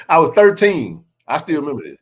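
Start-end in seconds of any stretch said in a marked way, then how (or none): none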